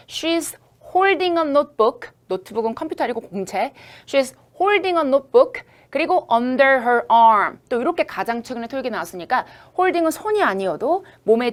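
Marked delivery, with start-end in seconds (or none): 0.1-2.1 She's holding a notebook, slow, a bit higher; slow model reading. Shown as a demonstration. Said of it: "이걸 영어로 묘사해". 4.1-7.6 She is holding a notebook under her arm, slow and raised; slow model reading. Shown as a demonstration. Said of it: "이걸 영어로 묘사해".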